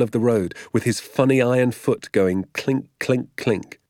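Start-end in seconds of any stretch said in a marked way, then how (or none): none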